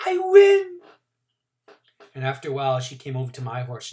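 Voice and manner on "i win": put-on high, loud child's cry of triumph; girl playing on the carousel, shouting in glee. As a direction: yell it like you just won a race. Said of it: "Jeannie kept shouting".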